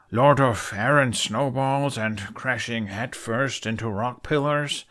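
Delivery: slow, mocking title; sarcastic, drawn-out teasing; dripping sarcasm. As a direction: roll out the insult like an honour. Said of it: "sarcasm dripping from his words".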